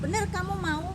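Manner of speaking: high, loud insistent question; retold probing question; pressing to make sure. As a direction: press hard for confirmation, pitch up. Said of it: "nanya tiga kali untuk meyakinkan".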